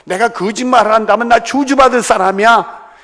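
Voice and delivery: high, loud self-cursing vow; emphatic oath. Acted. Peter cursing himself to make his word sure, vehement. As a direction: swear it forcefully to be believed. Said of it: "자기 말을 확실하게 하기 위해서".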